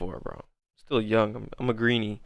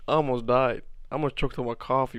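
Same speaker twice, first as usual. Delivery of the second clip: normal talking voice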